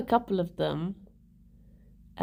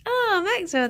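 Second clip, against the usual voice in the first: ordinary talk, nothing marked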